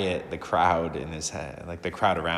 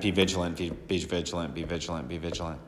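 each be vigilant softer than the last; fading repeated call; 3 semitones lower, 6 dB quieter, faster than usual. Acted. Priest to parish, urging, then trailing off. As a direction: let the call fade away.

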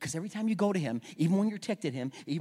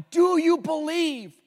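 loud, slow challenge; 11 semitones higher, 10 dB louder, slower than usual. Acted. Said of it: "challenge to congregation".

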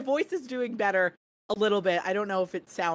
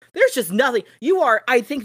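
emphatic outburst; 2 semitones higher, 9 dB louder than usual. Real, not acted.

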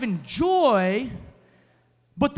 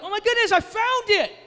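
high, bright put-on discovery, a bit louder; mock surprise; 12 semitones higher, 5 dB louder than usual. Pranking spouse pretends to find the hidden phone, high mock surprise. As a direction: fake the delight of finding it. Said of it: "And then be like".

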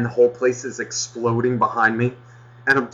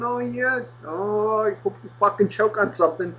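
high sarcastic murmur; fake-sweet high voice, under the breath; 10 semitones higher, 3 dB quieter than usual. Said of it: "And I'm going".

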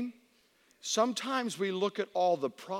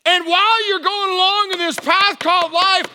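high, loud, driving proclamation; forceful preached exhortation; 10 semitones higher, 11 dB louder than usual. Retold or imitated. God exhorting David on life's path, preached shout.

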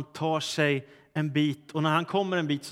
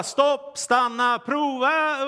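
high, raised storyteller's cry; panicked calling out; 9 semitones higher, 4 dB louder, much slower than usual. A narrator's reading. Rider desperately trying to stop the galloping horse, panicked calling out. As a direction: call out urgently, pitch jumping up. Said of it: "han börjar ropa".

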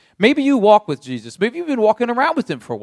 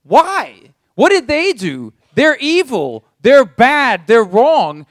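indignant; 5 semitones higher, 4 dB louder, slower than usual. Acted. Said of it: "we stand back and go".

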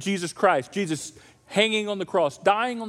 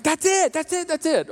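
excited approval; 8 semitones higher than usual. Retold or imitated. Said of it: "I'm like".

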